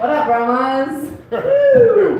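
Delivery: loud, high hype to the crowd; hyped greeting. Real, not acted. Comedian greets the crowd at the mic with a loud excited shout. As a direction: pump up the room.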